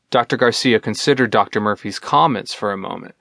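plain reading voice

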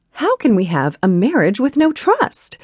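put-on high, loud haughty young woman; defiant heroine. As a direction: needle him with lofty defiance. Heroine voiced in the story.

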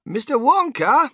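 put-on high, loud boy's call; yelling over noise. A narrator's reading. Boy getting the inventor's attention, high yell. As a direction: call up over a roaring wind. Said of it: "he yelled above the noise".

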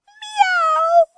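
very high, loud innocent meow. Acted. Kittens face Molly, very high innocent meow. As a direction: meow sweetly as if nothing happened. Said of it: "said all together".

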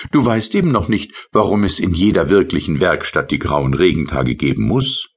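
high, light little sprite voice; tiny rain sprite. Acted. Tipsel's voice in story. (hohe, leichte Koboldstimme)

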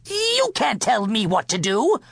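high-pitched, mildly shouted protest; shouted defiance. A narrator's reading. King defying the cook, shouting. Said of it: "he shouted".

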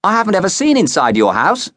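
put-on pushy girl pressing her point, pitched high; insistent and nosy; bossy. A narrator's reading. Bossy girl pushing to see a classmate's house, insistent. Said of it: "Using her bossiest voice".

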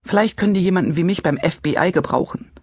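ordinary delivery, nothing marked

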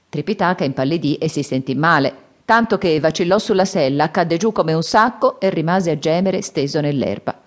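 ordinary delivery, nothing marked

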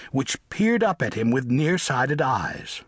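usual speaking voice

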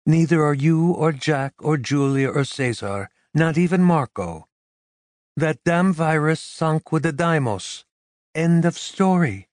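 high, firm dismissive woman; insistent woman. A narrator's reading. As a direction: shut the worry down. Carmen insisting.

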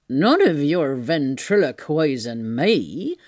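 slow, rough-spoken tramp's protest; indignant tramp. Acted. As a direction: refuse to be fooled, drawing words out. Tramp challenges unseen voice.

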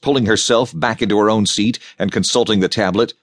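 usual speaking voice

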